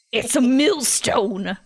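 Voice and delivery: slow, attempted cartoon crone; badly faked ancient granny, drawn out; SpongeBob old lady. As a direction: try a cartoon old-lady screech, half failing. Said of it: "No, I can't do that voice".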